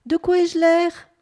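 high, loud, drawn-out question; excited cry. A narrator's reading. Newly named bunip asks about his looks, crying out. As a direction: cry it out, pitched well up. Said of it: "il se redressa et s'écria".

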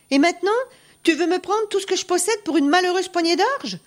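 high-pitched, incredulous protest; indignant self-defence. A narrator's reading. Peasant protesting the seizure of his goods, indignant self-defence. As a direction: plead your case, voice lifted high in disbelief. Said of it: "se défendit le pauvre paysan".

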